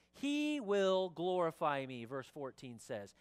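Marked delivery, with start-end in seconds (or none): 0.1-2.0 slow, loud, raised proclamation; emphatic scripture reading. Acted. Pastor reads Jesus on the coming Spirit, proclaiming. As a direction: draw out each word of the verse forcefully.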